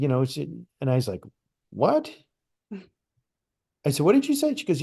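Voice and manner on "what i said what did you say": jumps way up in pitch, stunned; a startled, incredulous squeak of a question; shocked disbelief. Retold or imitated. To his ex-wife on hearing her matching vision, stunned high disbelief.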